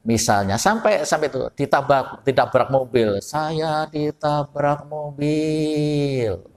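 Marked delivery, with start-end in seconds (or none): 3.2-6.5 slow, gentle, very soft-spoken; soft Javanese speech. Retold or imitated. Preacher imitating a gentle Javanese man hit by a car, slow and soft. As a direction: say it softly as a polite Javanese would. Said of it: "Orangnya lembut sekali kalau ngomong".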